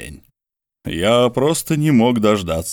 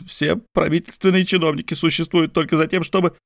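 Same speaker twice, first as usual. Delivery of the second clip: higher voice, exasperated rant; Mordecai's weary complaint; 7 semitones higher than usual. A narrator's reading. Mordecai rants to George about his luck, higher voice. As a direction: complain about taxis chasing him, pitched high.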